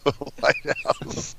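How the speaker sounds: slow, said through laughter; slow laughing echo of the lighthouse line; laughing quote. Retold or imitated. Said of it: "laughing quote".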